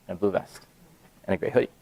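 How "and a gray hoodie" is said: usual speaking voice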